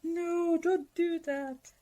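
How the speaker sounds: dismayed reaction. Acted. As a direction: draw out a playful plea of disapproval. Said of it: "The reaction that I have now for that is like".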